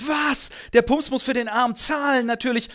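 höher und lauter, aufgebracht; empört. Acted. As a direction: sie kann es nicht fassen. Eva empört über Pumps. (Eva empört zu Franz, höher und lauter)

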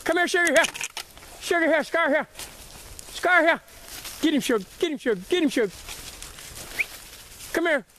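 high sing-song dog calls; yelling from the sidelines. Real, not acted. Urging the dogs on the rabbit.

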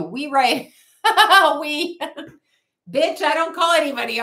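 high, loud laughing rant. Real, not acted. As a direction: laugh through the words. Laughing outburst.

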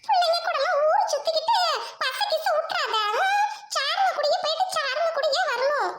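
high-pitched doting granny voice; fussing grandmother. Acted. Grandmother warning her grandchild before the school trip, high and fussing.

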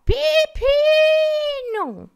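one long high call. Retold or imitated. Mother on the ladder calling at a passing parakeet, long high call. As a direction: stretch the name out as she calls. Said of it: "ela grita".

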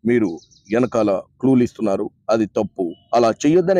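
low, measured voice of authority; imitating Bigg Boss. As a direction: deliver a low, deliberate reprimand as Bigg Boss. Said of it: "ఎందుకు చెప్పలేకపోయిండు ఆయన?".

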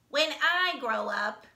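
drawn-out proud "When I grow up"; proudly. Acted. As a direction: puff up like a little show-off. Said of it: "he said proudly to the frog".

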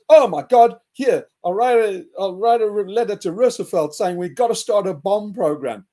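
hammed-up alarmed scientist; alarmed Einstein. Einstein hearing of the German weapon, mock panicked. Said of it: "And he says".

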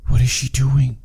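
hushed unvoiced whisper. Real, not acted. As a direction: whisper so she won't hear. Whispering while watching the girl.

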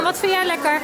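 ordinary talk

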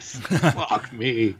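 ordinary talk, nothing marked

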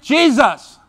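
high, loud call of one name; crying out. Acted. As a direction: shout the name to someone out of reach. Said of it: "He began to cry out and say".

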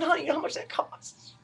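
high, soft incredulous question; mock incredulous husband. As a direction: question her in disbelief. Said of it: "And I said".

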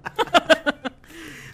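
high, loud burst of laughter. Real, not acted. Laughing after a joke about censored TV, loud cackle.

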